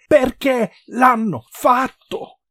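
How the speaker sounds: high, loud, slow anguished shout; screamed, drawn-out demand for an answer; desperate cry. A narrator's reading. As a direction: cry the question out word by word. Desperate woman repeats screamed question.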